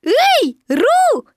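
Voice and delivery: high, loud squeaky shout; Piglet calling. A narrator's reading. Piglet calls up to Roo, shouting. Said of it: "крикнул Пятачок".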